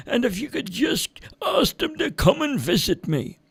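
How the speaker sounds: put-on high, soft, lonely old man's request. A narrator's reading. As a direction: ask gently, lonely and forgotten. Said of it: "an older man sitting there".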